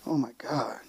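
hushed, breathy whisper of dread; frightened whisper. Retold or imitated. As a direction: whisper it looking down from the ride. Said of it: "she's like".

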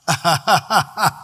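quick rhythmic chuckle in a string; hearty laugh. Real, not acted. Preacher laughs after own joke.

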